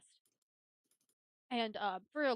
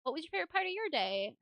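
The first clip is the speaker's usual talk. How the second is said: lightly acted, higher-pitched parent question; parent asking kids; 7 semitones higher, 4 dB louder than usual. Mom going round the dinner table, mock eager.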